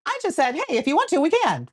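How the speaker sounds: high, light, easygoing retelling; imitated breezy, no-pressure offer; embellished casual tone. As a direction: pitch it way up, as if innocently casual. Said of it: "embellishing his tone".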